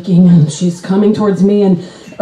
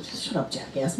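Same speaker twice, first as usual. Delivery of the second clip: very low, barely audible comeback; tiny muttered insult; muttered retort; 8 semitones lower, 18 dB quieter than usual. Acted. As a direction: grumble it under your breath.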